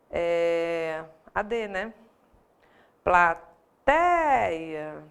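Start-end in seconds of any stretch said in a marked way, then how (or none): 3.0-5.0 "Plateia" split slowly, with a long, loud TEI; tônica destacada. Shown as a demonstration. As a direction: stretch the stressed middle of "Plateia". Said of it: "eu separo a sílaba". ("Plateia" silabado, com TEI forte e alongado)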